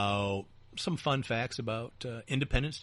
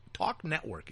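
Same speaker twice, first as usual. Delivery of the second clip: ordinary talk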